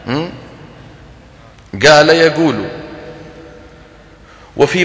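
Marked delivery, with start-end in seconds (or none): 1.9-2.6 قال with a glottal catch, يقول with a hard g; qaf as g. Shown as a demonstration. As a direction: say يقول with a g sound in place of qaf. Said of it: "يجعلونه جا".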